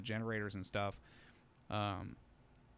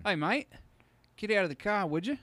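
higher, louder mock Australian cop; Australian cop accent; 10 semitones higher, 9 dB louder than usual. Police officer telling the driver to step out, in a mock Australian accent. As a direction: play a cheery Aussie police officer. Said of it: "Australian accent".

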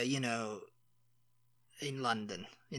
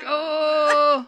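mock embarrassed "oh", high and loud; drawn-out imitation of mortified realisation; hammed-up embarrassed "oh"; 10 semitones higher, 9 dB louder than usual. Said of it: "she got all embarrassed".

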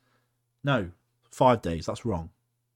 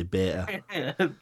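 Leeds pronunciation of "beta", T dropped; drawn-out Leeds "beta" with a glottal gap; Leeds accent; 2 semitones higher, 3 dB quieter than usual. Shown as a demonstration. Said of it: "If you're from Le-, Leeds, say".

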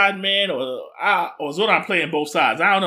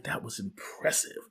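low, hushed mock admiration; impressed murmur; 8 semitones lower, 14 dB quieter than usual. Villain admiring the ship before her order, hushed impressed voice. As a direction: drop right down, quietly impressed. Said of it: "she was impressed".

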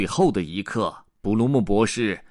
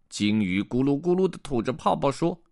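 plain reading voice

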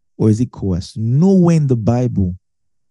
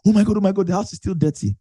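put-on panicked kid; 6 semitones higher, much faster than usual. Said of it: "you're like".

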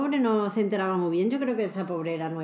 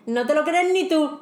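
higher, louder scoffing aside; 6 semitones higher, 4 dB louder than usual. Shown as a demonstration. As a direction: scoff at a tall tale. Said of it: "como diciendo".

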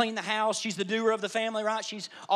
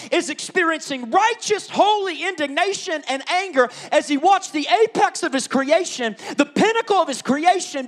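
preaching crescendo; 5 semitones higher, 9 dB louder than usual. Acted. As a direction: rise to the climax of the sermon. Preaching climax.

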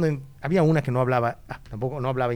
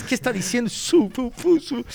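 high mock Québécois mumble; mock Québécois accent; 7 semitones higher than usual. Describing rural Québec speakers to a friend, high mock Québécois accent. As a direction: parody the Québécois accent with nonsense sounds. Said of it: "hablan con un acento inentendible".

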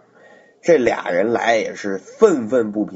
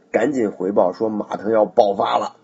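ordinary delivery, nothing marked

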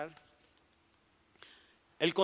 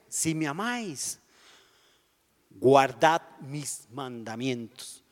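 solemn scripture; slower than usual. Retold or imitated.

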